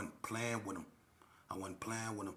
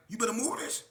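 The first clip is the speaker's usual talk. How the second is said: mock threat; 9 dB louder than usual. Retold quarrel.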